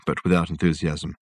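ordinary delivery, nothing marked

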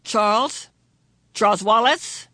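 father calling his son, crying out; cried out. A narrator's reading. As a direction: call out to the boy in the cell. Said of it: "he cried out".

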